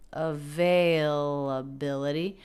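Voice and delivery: "availability" slow, with a lift on "vai"; secondary stress on veil. Shown as a demonstration. Teacher pointing out the secondary stress on "vai". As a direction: give "vai" a little extra weight. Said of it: "the syllable veil".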